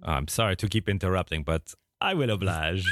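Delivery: normal talking voice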